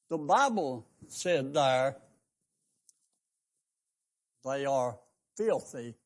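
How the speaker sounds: emphatic country preacher; preaching. Acted. Preacher pleading with his church, emphatic sermon. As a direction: drive the question home to the pews.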